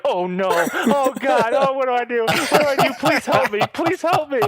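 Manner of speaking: mock fright to string the scammer along; mock panic. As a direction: pretend to be terrified and helpless. Faking panic to a phone scammer.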